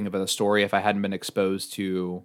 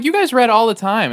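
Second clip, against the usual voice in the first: high mock parent voice; 8 dB louder than usual. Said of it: "I'm like".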